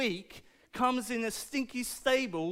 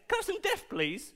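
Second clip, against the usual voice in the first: mock sweet request; 9 semitones higher than usual. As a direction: ask for death in a silly sweet squeak. Mock asking for death.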